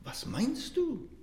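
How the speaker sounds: high, slow, amazed asking; astonished question. Retold or imitated. Monk Tauler asks the beggar, astonished. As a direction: ask it in utter disbelief. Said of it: "fragte Tauler ganz erstaunt".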